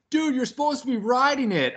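a hint of a jeering passerby calling out; mocking passerby. Passersby heckle him walking scooter.

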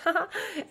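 a short high giggle; quick bright laugh. Real, not acted. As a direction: laugh it off lightly. Laughing at own age.

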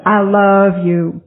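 put-on slow, drawn-out Southern US accent; southern drawl. Sponsor telling the speaker she loves her, slow Southern drawl. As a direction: stretch each word like a Southern sponsor, tender. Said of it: "telling me in her southern drawl".

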